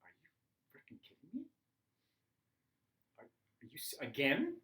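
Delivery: exasperated mock disbelief, dropping to a hiss; incredulous retort. Stepdad scoffing at the stepson's call, mock exasperated. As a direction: scoff at the repeated request. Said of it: "And he'd be like".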